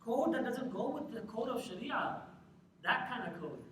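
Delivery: usual speaking voice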